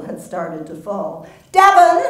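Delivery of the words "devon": very high, loud call of a name; mother calling after a lost daughter. Acted. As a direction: shout the name down the street. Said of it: "she called out".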